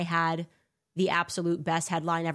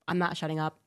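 ordinary talk, nothing marked

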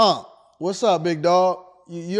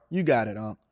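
ordinary talk, nothing marked

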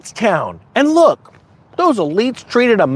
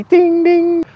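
mock chime; 9 semitones higher than usual. Flight attendant chime in skit.

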